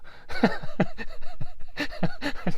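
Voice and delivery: soft airy laugh; breathy laugh. Real, not acted. Laughing at own joke.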